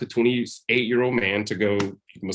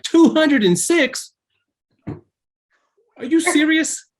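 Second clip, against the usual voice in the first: stunned disbelief; 6 dB louder, much slower than usual. Retold or imitated. Shock at teacher's answer.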